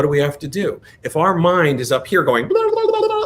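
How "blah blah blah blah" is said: mocking chatter. Mimicking the noisy mind.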